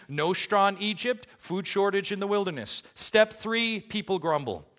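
high, loud pulpit delivery; full-force preaching. Acted. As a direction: drive each point out to the congregation.

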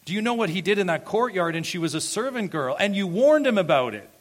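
loud, high-pitched incredulous protest; indignant reader. Acted. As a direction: shout it like an outraged objector. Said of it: "any reader of John's gospel would say".

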